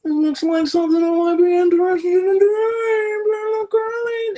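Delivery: mock curious voice. Kid discovering curling online, slow hammed-up high curious voice. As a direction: play the rare kid who's hooked, savour it. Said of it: "five percent that's like".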